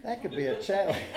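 chuckling, amused rise; tickled by the idea; real laughter breaking in; laughing. Real, not acted. Joking about ounce-per-pound rule.

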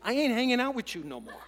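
loud blunt New York line with a laugh; head tilt, loud and laughing; New York approach. Shown as a demonstration. Preacher repeats the New York line with a laugh. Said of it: "That's the New York approach".

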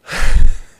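long loud breathy sigh into the mic; a loud theatrical sigh before starting the story; big sigh. Real, not acted. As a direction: let out a heavy exaggerated sigh.

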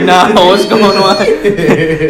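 loud, slow, cracking up mid-cry; laughing panicked yell. Retold or imitated. Friends yelling at the creature, loud and laughing. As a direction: shout it while laughing helplessly. Friends spooked by hopping Felipe.

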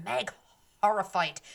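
plain reading voice

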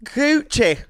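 high squeal. Pretend. Very high loud playful.

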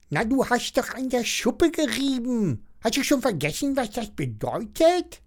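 high, indignant dragon voice. A narrator's reading. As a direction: scold her in a high creature voice. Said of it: "fragt Hector entrüstet". (hohe, entrüstete Drachenstimme)

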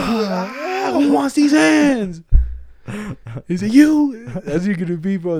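high, loud, slow hammed-up; mock tough challenge. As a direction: challenge the crowd to fight. Playful fight challenge.

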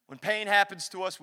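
ordinary delivery

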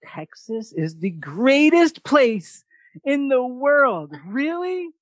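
mock swooning. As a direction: gush about Texas as if smitten. Mock dreamy reaction to Texas.

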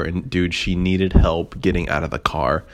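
ordinary talk, nothing marked

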